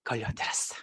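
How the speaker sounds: sly whispered aside; low, hushed gloat; inner voice. Acted. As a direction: whisper it secretly to yourself. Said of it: "용쌤은 속으로 말합니다".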